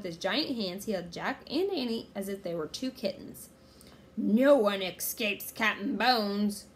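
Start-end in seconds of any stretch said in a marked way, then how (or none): 4.2-6.6 slow, loud menacing pirate voice; drawn-out villain's boast; pirate roar. A narrator's reading. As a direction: roar it out slowly as a threat. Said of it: "he roared".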